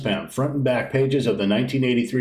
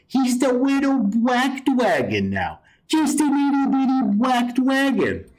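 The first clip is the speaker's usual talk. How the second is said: much higher, slow cutesy coo; teasing baby voice for the shrunken dragon; baby talk; 11 semitones higher than usual. Retold or imitated. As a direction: coo it like he's a tiny baby. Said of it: "like he would talk to a child".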